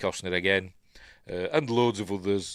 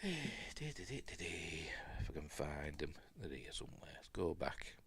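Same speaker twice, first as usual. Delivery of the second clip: much softer, low search mumble; muttering to self; 4 semitones lower, 16 dB quieter, slower than usual. Real, not acted. Host searching for his list, muttering to himself. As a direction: talk under your breath while looking.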